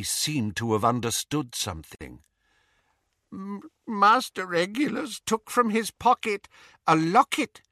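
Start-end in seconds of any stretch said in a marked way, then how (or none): 3.3-7.6 high, croaking elf voice through tears; a weeping old servant elf, voice high and wet; tearful elf pleading with his masters; tearful house-elf. Acted. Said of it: "said Kreacher, tears pouring down".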